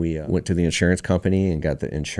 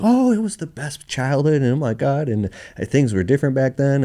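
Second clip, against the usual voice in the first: high-pitched imitation of an aunt reminiscing; gushing aunt; 8 semitones higher than usual. Aunt reminiscing to nephew on the phone, gushing. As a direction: gush fondly about childhood, pitched up.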